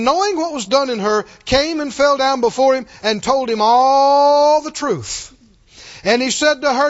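loud, high, dramatic scripture telling; forceful preaching. Real, not acted. Preacher retelling the healed woman's story, forceful preaching. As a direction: proclaim the story, stretching the climactic words.

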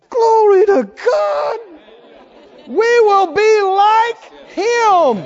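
high, loud, slow cry of praise; joyous shout. Retold or imitated. Preacher crying out praise at seeing Christ's glory. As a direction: shout glory like you see him. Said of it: "We're gonna go".

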